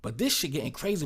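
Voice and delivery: ordinary talk, nothing marked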